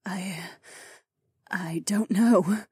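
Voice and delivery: whispered, slow, unsure; falter, losing the words. Acted. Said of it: "Anwar faltered".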